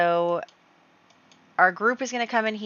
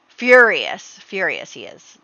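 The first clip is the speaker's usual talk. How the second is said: dramatic fury; 9 dB louder, slower than usual. Pretend. Narrator reveals the Earl's reaction, dramatic and loud. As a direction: announce it with mock outrage.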